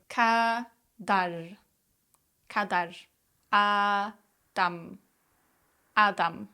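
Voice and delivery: each word split clearly into syllables; "Kadar" and "Adam" said one syllable at a time. Shown as a demonstration. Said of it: "say these words syllable by syllable".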